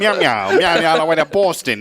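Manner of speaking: mock Boston cat meowing through a laugh; laughing cat impression; cat with an accent. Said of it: "did it come back with an accent".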